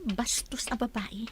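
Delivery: under-the-breath whisper of contempt; whispered scorn aside; whispered insult. Acted. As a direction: hiss it so nobody hears. Muttered insult behind mother's back.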